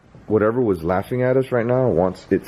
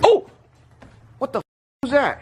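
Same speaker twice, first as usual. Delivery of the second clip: startled; 12 semitones higher than usual. Real, not acted. Startled by something falling.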